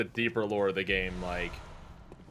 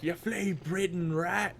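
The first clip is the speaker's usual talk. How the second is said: high, drawn-out mock villager cry without added volume; mock villager scream; 9 semitones higher, slower than usual. Player imitating villagers screaming at the hunter, high mock cry. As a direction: imitate a villager crying out, pitched up and slow. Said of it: "villagers will scream out stuff".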